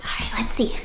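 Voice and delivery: ordinary delivery, nothing marked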